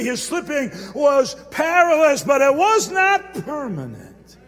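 slow, shouted sermon climax; preaching shout. Acted. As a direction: bellow the refrain slowly. Climax repeat of refrain.

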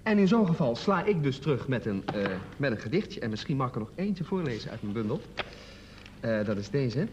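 ordinary talk

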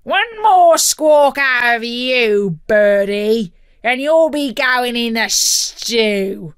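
loud, slow menacing snarl; snarling pirate captain voice. A narrator's reading. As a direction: threaten the bird as a pirate captain. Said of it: "snarled Captain Blackheart".

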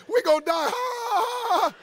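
very high wail breaking into a laugh; hysterical fearful laughter. Retold or imitated. Preacher imitates his wife fearing death, hysterical high laughing wail. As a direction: imitate terrified, out-of-control laughing. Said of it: "she begins to laugh hysterically".

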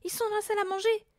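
fast, high panicky voice; strangled panic. A narrator's reading. Mother locating the burglars, strangled panic. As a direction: blurt it out high and rushed. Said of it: "d'une voix étranglée".

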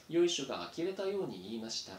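ordinary delivery, nothing marked

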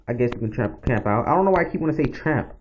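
usual speaking voice

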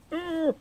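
high loud frustrated ugh; exasperated grunt; annoyed groan. Real, not acted. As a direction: let out a fed-up groan. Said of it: "I'm in such a annoyed state".

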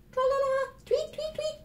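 very high chirping; bird song. Acted. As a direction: sing it out like a little songbird. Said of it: "Open your beak and sing".

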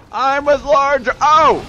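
high, loud drawn-out yell. Pretend. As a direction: yell it out while running by. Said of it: "Start yelling as you run by".

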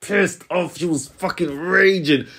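ordinary talk, nothing marked

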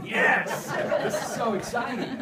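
high, loud burst of delight with laughter; excited cheer. Retold or imitated. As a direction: shout it out, overjoyed and half laughing. Retold delighted reaction to prayer request.

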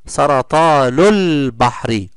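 سرطان البحر read slowly with the hamza dropped, النون joined to اللام; drawn out to show the linking; slow linked reading. Shown as a demonstration. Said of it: "فقلنا سرطان البحر مباشرة ولم ننطق". (نطق بطيء بلا همزة)

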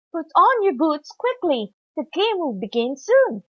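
brisk call to get moving; urgent coach. A narrator's reading. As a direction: hurry the team along. Coach hurries team before match.

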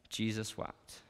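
hushed reverence. Real, not acted. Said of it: "Shortest verse in the English Bible".

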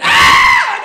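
high, very loud mock shriek; piercing comic terror scream; baby goat scream. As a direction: cut in with a bleating scream of fright. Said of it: "he screams like a little baby goat".

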